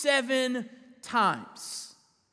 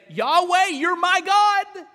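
higher and louder, "you're my God" slowed; fervent cry; 8 semitones higher, 8 dB louder, slower than usual. Acted. Preacher crying out as the psalmist, loud and fervent. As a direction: call out to God with full conviction.

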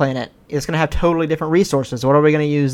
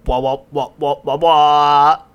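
slow, louder wah-wah babble; muffled wah wah talk; 5 dB louder, slower than usual. Retold or imitated. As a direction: wah wah like a muted trumpet voice. Wordless stand-in for absent host.

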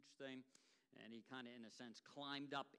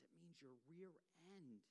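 a hint of a hushed whisper; whispered aside; 12 dB quieter than usual. Pastor explains the word to the guest, lightly whispered. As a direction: lean in and say it under your breath. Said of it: "whispers into his ear".

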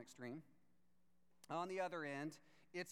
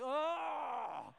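shocked shout; 8 semitones higher, 8 dB louder than usual. Retold or imitated. Brad reacting to Monica.

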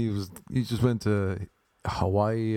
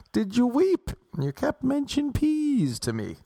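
coy woman voice; 9 semitones higher than usual. Pretend. Woman's voice recounting the night.

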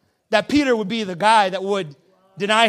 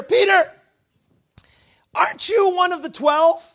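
higher-pitched accuser, mildly challenging; lightly voiced accusing lady; 6 semitones higher than usual. Lady by the campfire questioning Peter, mildly accusing. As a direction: call him out with raised pitch. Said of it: "the lady says to Peter".

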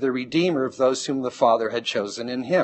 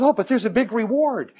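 much higher, mock wheedling voice; coaxing father; 10 semitones higher than usual. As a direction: sweeten the deal like a salesman. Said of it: "the father says".

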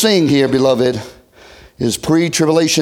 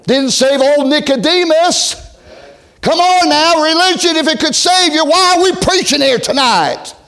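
higher, building preacher's cry; fiery preaching; 9 semitones higher than usual. Acted. Preacher pressing the congregation at full force. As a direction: press the point home with rising fire.

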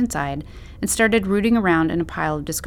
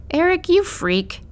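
laughing; 11 semitones higher, slower than usual. A narrator's reading.

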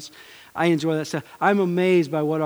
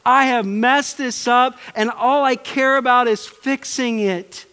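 much higher, louder, slow pleading urgency; desperate remorse; 9 semitones higher, 7 dB louder than usual. Retold or imitated. Preacher voicing a repentant spouse, urgent pleading. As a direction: cry it out like someone desperate to fix it. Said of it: "you come to an attitude like".

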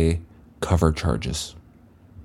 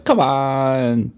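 higher, drawn-out plea; pleading; 6 semitones higher than usual. Retold or imitated. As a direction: whine it out in a long plea. Coach pleading with bouncer.

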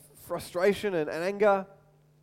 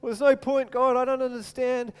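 pleading cry to God; 5 semitones higher, 4 dB louder than usual. Retold or imitated. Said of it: "Moses kinda cries out to God".

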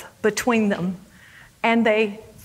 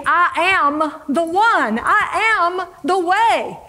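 slow, higher "I am", each phrase proclaimed firmly; "I am" stretched and raised in emphatic proclamation; insistent declaration; 5 semitones higher, slower than usual. Acted. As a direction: lean hard on "I am", drawing it out.

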